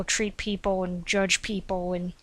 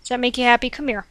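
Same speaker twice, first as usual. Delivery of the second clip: usual speaking voice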